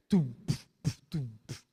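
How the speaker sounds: spoken drum sounds for a champeta rhythm; beatbox the drum with your mouth; mock tum-puf percussion; imitated champeta beat. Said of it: "champeta a lo que marca".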